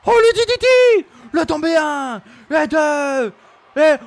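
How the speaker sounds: very high, loud shouted count; overexcited pin count, high and loud; excited screaming. Real, not acted. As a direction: shriek the DDT and the count.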